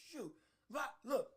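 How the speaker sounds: normal talking voice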